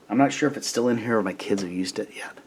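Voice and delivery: quick whispered aside to the listener. Real, not acted. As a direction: drop to a fast whisper, as if to yourself. Aside while reading to kids.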